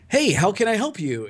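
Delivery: high, bright hey; overly chipper greeting. Retold or imitated. Pickle answering the phone at work, overly cheerful. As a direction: greet the caller way too cheerfully. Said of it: "and I'm like".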